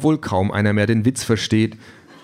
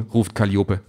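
ordinary delivery, nothing marked

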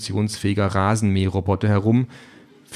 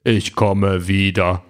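Terminator growl; 3 dB louder, much slower than usual. A narrator's reading. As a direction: rumble it like a movie cyborg. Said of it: "Mit tiefer Stimme und seltsamem Akzent brummt".

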